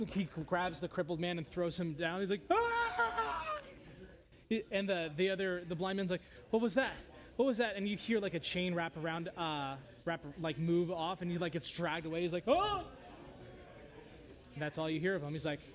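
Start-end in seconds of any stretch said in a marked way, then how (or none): 2.5-3.6 long, high scream of a man thrown down; hammed-up high wail; acted falling scream. 6.5-7.8 higher, louder startled questions; alarmed blind man. Acted. Blind man hears companion screaming. 12.5-12.8 short, high, loud yelp; hammed-up high cry; acted startled cry. Blind man dragged away.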